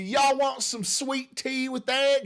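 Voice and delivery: put-on Tennessee hospitality in a drawn-out Southern twang. Streamer imagines being in Tennessee, mock Southern twang. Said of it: "Get that Southern twang going".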